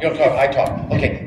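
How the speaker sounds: ordinary talk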